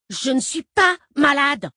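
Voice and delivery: high, loud, furious denial; angry shout. A narrator's reading. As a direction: shout it back in outraged protest. Gamin nie sa maladie. (cri de colère, aigu et fort)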